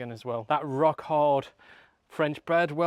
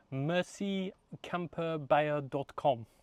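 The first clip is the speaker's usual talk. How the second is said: slow, hammed-up French accent on the sign-off; best French accent; 2 semitones higher, much slower than usual. Shown as a demonstration. Host signing off to viewers in a mock French accent. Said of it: "in my best French accent".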